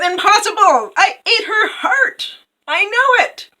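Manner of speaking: high, loud, shrieked denial; hammed-up furious villainess; outraged queen. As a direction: screech it in disbelief.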